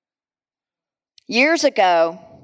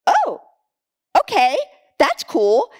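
high startled oh, then settling; mock surprise; 4 semitones higher than usual. Preacher reliving people falling out at Bible study, mock surprise. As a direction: act out being taken aback, then accepting. Acts out her startled reaction.

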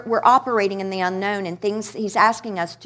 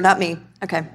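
ordinary delivery

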